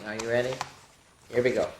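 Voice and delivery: ordinary delivery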